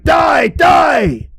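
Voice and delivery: high, loud screaming Die; furious shout. Acted. Murderer rushing at the old man, screaming in fury. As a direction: scream it as you rush in.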